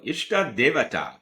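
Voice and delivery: Ishta Devata in a mock Indian accent, pitched high; quick high Indian-accent echo of the mantra. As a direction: imitate an Indian man saying Ishta Devata. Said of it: "and in an Indian accent, I go".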